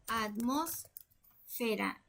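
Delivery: atmósfera slowly, split with a long pause before "fera"; syllable-by-syllable dictation. Shown as a demonstration. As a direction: sound out "atmósfera" softly as if writing it. Sounding out word while writing it.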